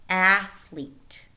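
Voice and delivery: ATH-lete, "athlete" hit loud on the first syllable; stress on first syllable. Shown as a demonstration. Teacher modelling "athlete" for learners, strong first syllable. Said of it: "The stress falls on the first syllable".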